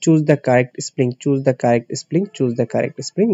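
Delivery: slow, spaced reading of the instruction; slow reading aloud. Shown as a demonstration. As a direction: read it slowly, like dictating. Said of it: "এইভাবে থাকে". (ধীরে ধীরে পড়া)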